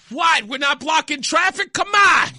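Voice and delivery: loud, high mock whine; hammed-up shout of disappointment; mock outrage. Reporters shouting in mock disappointment at no traffic blocked. Mocking protest-seekers' disappointment.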